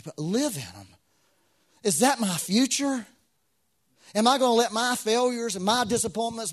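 loud, high, impassioned preaching with a sung lilt; full-force preaching. Acted. Pastor urging the congregation not to live in disappointment, loud and fervent. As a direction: lift the room with rising fervour.

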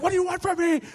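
indignant, almost shrieking; offended people crying out to God; indignant outburst. Acted. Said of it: "you can get the indignation".

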